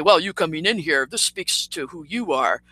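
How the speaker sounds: first loose try at his voice; rough impersonation. Retold or imitated. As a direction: lightly imitate him telling you off. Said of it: "Or let me get his voice down".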